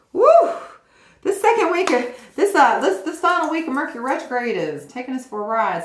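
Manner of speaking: high, loud whoop then rising chatter; excited woo. Real, not acted. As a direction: cheer it out loud, thrilled. Excited cheer about retrograde.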